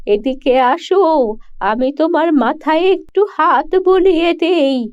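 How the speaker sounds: put-on old woman tenderly praising the good-hearted girl, slow and high. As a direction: praise her slowly like a grateful old crone. Old woman blesses kind girl.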